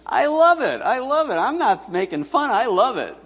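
high, loud, insistent delight; emphatic high-pitched insistence; delighted protest. Real, not acted. Protesting he isn't mocking her.

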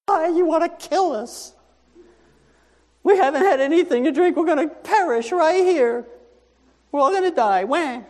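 high, panicky complaining crowd voice; whining Israelites. Acted. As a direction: wail it like desperate people sure they will die.

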